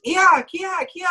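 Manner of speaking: high, thin ki without force; bad ki. Shown as a demonstration. Mocking a weak karate shout, high and thin. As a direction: squeak the ki instead of driving it.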